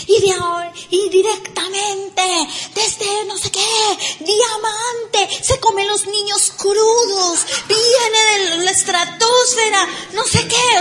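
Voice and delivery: mock the over-the-top introduction of the speaker; hammed-up announcer. Mocking the speaker's grand introduction.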